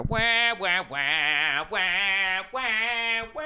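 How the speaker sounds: high vocal wah-wah guitar sounds; guitar wah imitation. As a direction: sing the guitar wails in falsetto. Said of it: "That's my [name] ex-, in-, uh, uh, impression".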